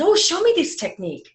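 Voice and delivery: high-pitched eager request; retold thrill, pitch leaping up; eagerly asking to be shown; excited. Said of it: "And I was so excited".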